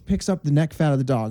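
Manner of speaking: ordinary talk, nothing marked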